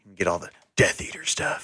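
hushed, secretive whisper. Acted. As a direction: breathe it like a dark secret. Dark and broody shopping.

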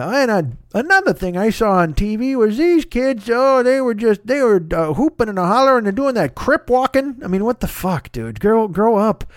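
hammed-up old codger griping about kids today; grumpy old man. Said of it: "I don't feel like an old man".

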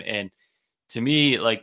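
ordinary talk, nothing marked

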